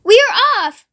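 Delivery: high, loud burst of excitement; excited cry. A narrator's reading. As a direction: cry out eagerly as a monkey setting off. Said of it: "cried the monkey".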